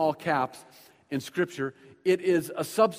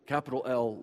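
usual speaking voice

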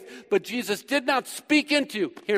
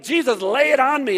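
bright, eager, a little louder; eager excitement; 5 semitones higher, 5 dB louder than usual. Retold or imitated. Philip eagerly asks Jesus for a new name, excited. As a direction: play an excited follower begging for his moment. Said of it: "he's excited".

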